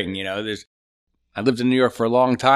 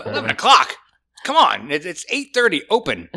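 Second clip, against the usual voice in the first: pushy New Yorker; 6 semitones higher than usual. Retold or imitated. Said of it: "pushy New Yorker".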